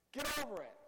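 high, loud dismissal; exasperated scolding. Acted. Speaker to guys dwelling on childhood trifles, exasperated scolding. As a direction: tell them off with a sharp rise in volume. Scolding imagined petty confessors.

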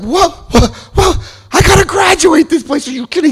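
very high-pitched incredulous protest; panicked disbelief. Retold or imitated. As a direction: squeal in mock outrage. Protests being thrown out.

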